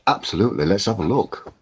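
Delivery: ordinary talk, nothing marked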